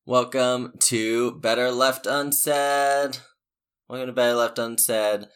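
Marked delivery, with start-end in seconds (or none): none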